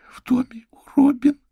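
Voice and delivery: high unvoiced shaking whisper of a scared child; trembling little girl whisper. A narrator's reading. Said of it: "дрожащим голосом ответила она". (дрожащий шёпот испуганной девочки)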